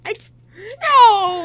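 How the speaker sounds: high, slow, crying-out mock despair; mock anguish. Cast member recalling the shocking line, mock wailing. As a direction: wail it like your heart just broke.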